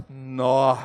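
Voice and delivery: very low, sinking "nó"; let-down Mineiro "nó". Acted. Mineiro seeing little money, low let-down "nó". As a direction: drop "nó" right down in disappointment. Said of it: "Deu pouco dinheiro".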